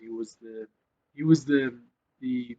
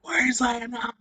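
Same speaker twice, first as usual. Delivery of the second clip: much higher, loud hyped-up team roar; retold war cry; 11 semitones higher, 6 dB louder, slower than usual. Queensland players in the corridor, loud war cry. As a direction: bellow it like a pumped-up team in the tunnel. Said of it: "they used to scream".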